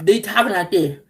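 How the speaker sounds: mock talking with teeth bared; imitating toothy talk. Vlogger mimicking a man who shows his teeth, mock toothy talk. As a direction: lightly mimic speech through bared teeth. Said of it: "be showing his teeth".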